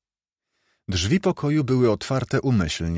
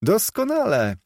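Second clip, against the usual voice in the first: amused exclamation; 11 semitones higher than usual. A narrator's reading. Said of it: "roześmiał się siostrzeniec". (wysokie, rozbawione zawołanie)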